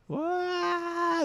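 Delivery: startled little cry; high yelp. Real, not acted. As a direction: yelp in surprise. Startled by window.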